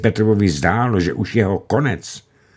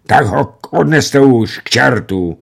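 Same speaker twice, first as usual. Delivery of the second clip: higher, louder, slow and strained; strained angry shout; 6 semitones higher, 6 dB louder than usual. A narrator's reading. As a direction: bark it out in a voice not his own. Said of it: "vykřikl velitel cizím hlasem". (vykřiknutí cizím hlasem)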